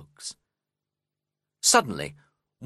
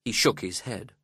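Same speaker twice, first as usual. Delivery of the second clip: ordinary delivery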